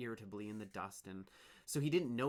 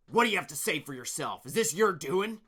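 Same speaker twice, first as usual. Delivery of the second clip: angry; 6 semitones higher, 13 dB louder than usual. Acted. Leader interrogates Dune.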